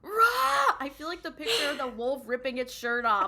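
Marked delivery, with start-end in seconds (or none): 0.0-0.8 high, loud cry of fan anguish; frustrated roar. Real, not acted. Co-host reacting to a painful plot point, frustrated roar. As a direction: let out a short, high roar.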